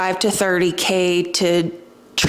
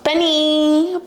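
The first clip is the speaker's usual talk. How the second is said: raised, higher call across the room; calling the dog; 7 semitones higher than usual. Real, not acted. To her barking dog Benny, a rising call. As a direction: call the dog's name to get his attention.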